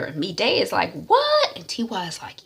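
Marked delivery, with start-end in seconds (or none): 1.1-1.5 very high, loud burst of disbelief; shocked what. Retold or imitated. Mide hearing about the native doctor, shocked yelp.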